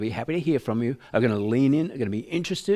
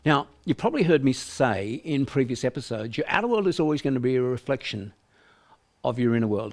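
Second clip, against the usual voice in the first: normal talking voice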